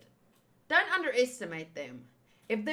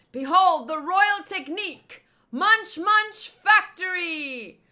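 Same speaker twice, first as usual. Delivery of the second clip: higher, louder, slowed-down proclamation; grand attack call; 6 semitones higher, 7 dB louder, slower than usual. Acted. Villain king unveiling his move, slow booming announcement. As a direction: announce the royal technique with pomp.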